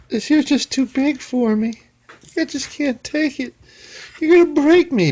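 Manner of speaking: very high, put-on helpless squeal; pleading mock voice. Voicing someone faced with too big a fit, mock pleading.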